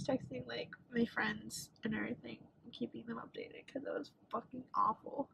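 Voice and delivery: barely voicing the words after crying; whisper. Real, not acted. Student telling viewers about texting friends, whispering.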